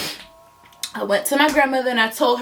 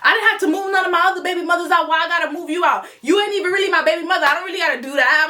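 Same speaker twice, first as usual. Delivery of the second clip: mock exasperation, higher and rattled off fast; exasperated complaint; 7 semitones higher, much faster than usual. Ex telling her why he won't move her out, exasperated. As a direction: imitate a man grumbling in a quick rush.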